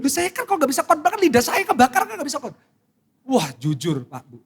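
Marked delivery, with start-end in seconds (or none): 0.0-2.6 fast indignant protest. Real, not acted. Pastor protesting to congregation.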